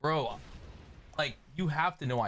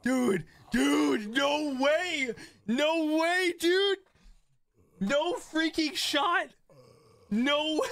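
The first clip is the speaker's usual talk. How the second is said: excited. Real, not acted. Celebrating lucky roll.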